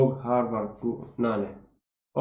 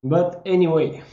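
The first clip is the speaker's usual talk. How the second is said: higher and louder, a breezy dismissal; shrugging it off; 6 semitones higher, 7 dB louder than usual. Real, not acted. Brushing off where the line came from, breezy shrug. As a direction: toss it off with a shrug in the voice.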